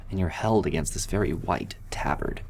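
ordinary talk, nothing marked

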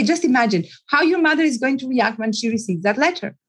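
normal talking voice

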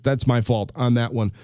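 ordinary delivery

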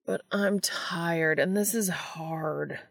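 slow, breathy near-whisper whine; whining. Acted. Exhausted Dean complaining to Castiel, slow whispered whine. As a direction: drag the words out like a worn-out kid. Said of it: "Dean whines".

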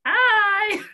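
high, loud squeaky greeting. Real, not acted. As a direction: pop up into a squeak on the hello. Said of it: "so squeaky".

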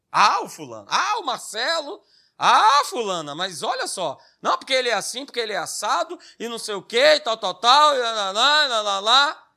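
mock gossip moaning to friends, swooping pitch; fofoqueira ao telefone. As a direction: act out a wife griping about her husband, running into singsong blah-blah. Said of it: "ligo pra minhas amigas pra fazer o quê? Pra falar mal dele". (imitando fofoca e reclamação com as amigas)

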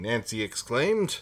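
ordinary talk, nothing marked

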